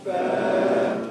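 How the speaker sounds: Fa. only breathed, no voice; whispered fa. Shown as a demonstration. Teacher demonstrating the letter in a whisper. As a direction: breathe Fa. out through lip and teeth. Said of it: "Fa is a light letter".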